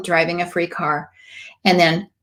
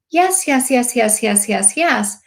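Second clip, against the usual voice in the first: eager yes person; 3 semitones higher, slower than usual. Retold or imitated. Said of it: "I was such a yes person".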